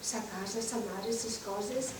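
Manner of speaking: a soft hint of Mallorcan Catalan; Mallorcan accent. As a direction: savour the Majorcan words quietly. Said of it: "me encanta hablar como oigo el mallorquín".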